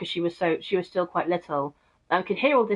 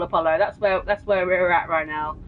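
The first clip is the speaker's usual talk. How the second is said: ordinary talk, nothing marked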